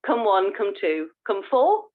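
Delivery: usual speaking voice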